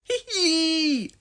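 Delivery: high mischievous tee-hee giggle; naughty giggle. A narrator's reading. Norman spying on the twins, naughty giggle. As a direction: giggle like a little troublemaker. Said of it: "giggled Norman".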